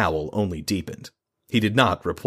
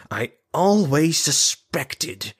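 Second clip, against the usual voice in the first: furious growl; 8 semitones higher, much slower than usual. Acted. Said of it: "trailed off into a furious growl".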